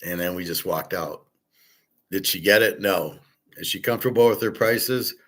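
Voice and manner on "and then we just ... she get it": usual speaking voice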